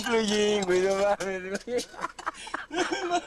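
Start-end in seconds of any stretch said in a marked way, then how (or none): 2.7-3.2 high, breathy laughing through words; giggling while describing. Real, not acted.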